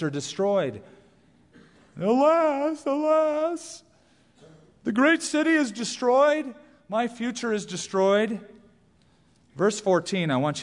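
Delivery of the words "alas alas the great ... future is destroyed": high, keening cry of a ruined merchant; wailing merchant. Acted. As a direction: wail it like a merchant who lost everything. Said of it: "notice what they're crying about".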